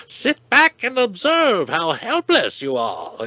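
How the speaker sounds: slow, high, hammed-up Chinese accent; cheesy cartoon villain with a Chinese accent; bad Chinese accent. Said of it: "in a really bad Chinese accent".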